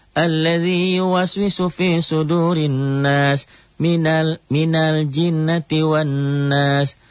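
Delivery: slow, high chanted recitation; Quran recitation. Acted. Teacher reciting surah An-Nas for the class, slow chant. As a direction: recite the surah slowly and melodiously.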